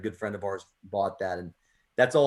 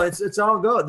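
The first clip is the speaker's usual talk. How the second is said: reassuring; 10 semitones higher, 6 dB louder than usual. Real, not acted.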